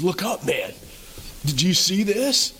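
an elder grabbing John, eager to show him; elder shaking John out of grief, eager; excited urging. Acted. Elder urges John to look.

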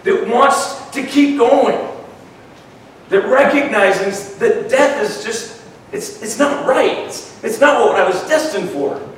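a touch of rising preacher fervor; preaching. Acted. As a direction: build the sermon with passion. Preaching builds up.

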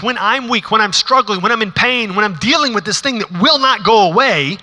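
fervent pulpit delivery; passionate preaching. Acted. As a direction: proclaim it with rising conviction. Preacher voicing Paul passionately.

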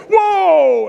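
put-on high, loud child's whoa; a little boy thrilled by a tug on the line; excited kid whoa. Little son reels in fish.

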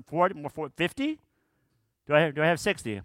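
playful auction bidding, rising pitch; auctioneer. Pretend. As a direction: call out numbers like an auctioneer. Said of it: "We could feel like an auctioneer here".

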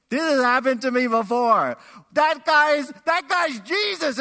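loud, high, bursting with recognition; Peter's thrilled shout as it dawns on him; excited realization. Acted. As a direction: cry it out in amazed excitement. Peter realizing who calls, acted.

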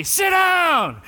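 high, loud mock order; shouted joke. Youth group teasing their pastor, a loud high mock order. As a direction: yell it as the running joke. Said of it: "they would always say".

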